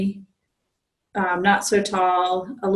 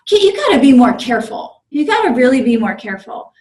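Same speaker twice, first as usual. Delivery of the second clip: loud, higher patronizing scolding; condescending conductor; 4 semitones higher, 7 dB louder than usual. Retold or imitated. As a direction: lecture him like a bossy older conductor. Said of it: "So then he's like".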